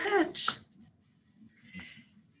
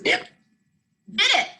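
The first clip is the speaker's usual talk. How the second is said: crying; 11 semitones higher, 3 dB louder than usual. Acted. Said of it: "he cried".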